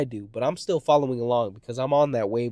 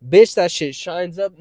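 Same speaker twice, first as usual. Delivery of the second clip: ordinary talk, nothing marked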